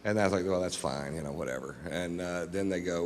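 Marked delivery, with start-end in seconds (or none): 0.5-1.7 softer, lower, shrugging throwaway; casual dismissive shrug, voice sinking away; mock nonchalance. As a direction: play it cool like nothing's wrong. Feigning nonchalance to the cops.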